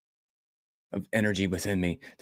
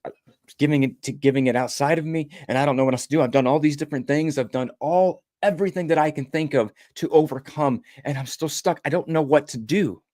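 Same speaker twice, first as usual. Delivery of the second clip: raised, strained voice throughout; desperate plea; 6 semitones higher than usual. Retold or imitated. Father begging the inner voice for answers, desperate. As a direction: plead like you're at the end of your rope.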